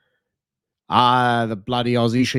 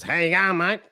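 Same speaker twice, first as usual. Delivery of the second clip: casual Australian accent, higher pitched; laid-back Aussie lingo; 6 semitones higher than usual. Shown as a demonstration. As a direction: imitate an easygoing Aussie greeting. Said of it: "It's so laid back".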